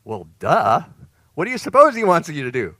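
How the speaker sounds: loud, high mock disbelief at an obvious question; sarcastic duh. Real, not acted. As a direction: scoff at how obvious it is.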